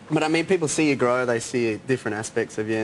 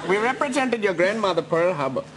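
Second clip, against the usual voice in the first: higher, oily put-on lawyer voice; sleazy lawyer voice; 6 semitones higher than usual. [name] introducing himself to [name], oily and higher. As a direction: play a slimy lawyer. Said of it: "[name] as Vermin Parasite".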